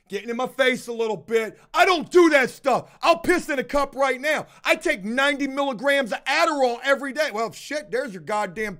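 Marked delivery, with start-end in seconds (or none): 1.7-7.3 louder, higher indignant protest; aggressive denial. Retold or imitated. Accused worker getting in the boss's face, aggressive denial. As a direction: imitate a man loudly insisting he's clean.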